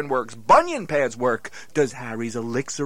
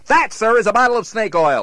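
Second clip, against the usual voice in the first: raised, pointed declaration; loud accusation; 7 semitones higher, 7 dB louder than usual. Acted. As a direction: call him out loudly.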